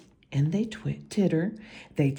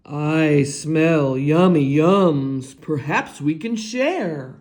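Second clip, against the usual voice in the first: badger voice; 3 semitones lower, 6 dB louder than usual. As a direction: savour the smell as the badger.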